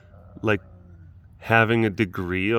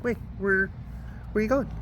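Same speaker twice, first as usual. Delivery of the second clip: cat voice; 11 semitones higher, faster than usual. Pretend. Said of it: "You're like".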